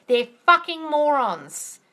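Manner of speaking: slow, disgusted insult; slow angry contempt. Real, not acted. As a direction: drag it out with contempt. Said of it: "Sorry to get so angry".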